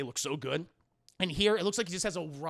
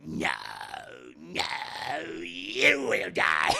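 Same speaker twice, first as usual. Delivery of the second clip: hammy Palpatine; 5 semitones lower, 4 dB louder, slower than usual. Retold or imitated. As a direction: overact the awful line, very slowly. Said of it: "the way he says this line is awful".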